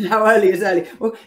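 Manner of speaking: laughing, fast and loud; laughing quick question. Real, not acted.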